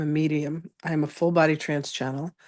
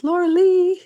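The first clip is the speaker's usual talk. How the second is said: much higher, louder, thrilled to see her; delighted greeting; 8 dB louder than usual. Real, not acted. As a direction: call out her name with joy. Greeting friends arriving online.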